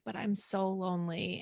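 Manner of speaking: hint of crying as she quotes the film; imitating tearful Jo March. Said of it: "she's, like, crying".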